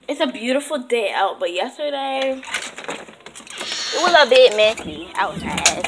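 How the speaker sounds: ordinary talk, nothing marked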